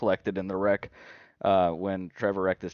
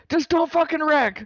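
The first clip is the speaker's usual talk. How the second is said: high-pitched, loud yell into his ear; screaming; 7 dB louder than usual. Retold or imitated. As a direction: re-enact screaming frantically at a driver. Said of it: "I was screaming in his ear".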